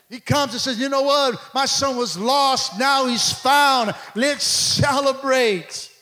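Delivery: loud, slow, drawn-out proclamation; triumphant pulpit shout; full-force preaching. Acted. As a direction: belt out the joyful announcement, stretching key words.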